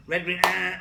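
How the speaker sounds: short loud mock honk; horn honk. Teacher mimicking an American driver honking at green. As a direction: tap the horn once, loud.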